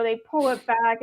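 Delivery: ordinary talk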